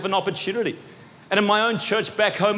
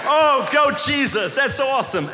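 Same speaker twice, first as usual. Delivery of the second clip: mock cheering; 8 semitones higher, slower than usual. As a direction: cheer it on like a hyped crowd. Church cheering the testimony.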